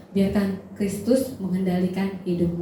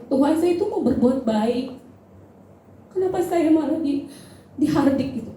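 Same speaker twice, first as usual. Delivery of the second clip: higher-pitched hurt complaint to God; retold tearful plea; 6 semitones higher than usual. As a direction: retell a wounded prayer, voice lifted. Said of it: "Saya nangis, saya bilang". (tiruan keluhan sedih kepada Tuhan)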